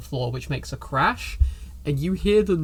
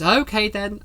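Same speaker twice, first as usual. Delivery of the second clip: higher, lightly voiced cleaner; lightly acted, raised-pitch acceptance; agreeable Teresa; 6 semitones higher, 4 dB louder than usual. Teresa accepting the excuse, lightly voiced and higher. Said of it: "And she goes".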